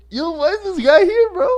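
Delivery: high-pitched retold shock; acted disbelief. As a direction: squeal it in mock outrage. Acting out his objection to guy.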